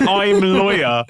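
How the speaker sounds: repeating the best line of the scam email in a mock West African accent; slow mock West African accent. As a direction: savour "I'm lawyer" in a hammed-up West African accent. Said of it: "I'm gonna do an accent".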